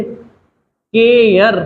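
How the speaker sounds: केयर stretched out slowly, with the एयर sound held; slow modelled word. Shown as a demonstration. Teacher drilling the एयर sound in केयर, slowly stretched. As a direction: draw out केयर so the class hears एयर. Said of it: "ये होता है एयर".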